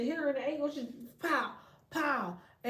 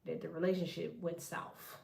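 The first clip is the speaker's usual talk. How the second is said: lower, much softer, very slow mocking; mock prim apology; 8 semitones lower, 9 dB quieter, slower than usual. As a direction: drag out their fake apology in a hushed sneer.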